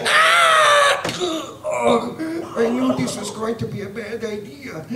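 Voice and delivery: high scream sinking to a battered, high-voiced groan; yelp, then lament like a squashed cartoon character; screamy hapless cartoon victim; Doctor Frog. Shown as a demonstration. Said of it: "When I did Doctor Frog, he was always being chucked".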